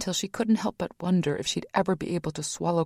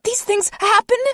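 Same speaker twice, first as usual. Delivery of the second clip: much higher, loud screech; furious mother screeching at friend; 8 dB louder than usual. A narrator's reading. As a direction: shriek it back at him. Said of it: "she screeched".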